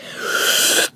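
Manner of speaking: long exaggerated gasp; shocked gasp. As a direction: suck in air in mock shock. Acted shock at her answer.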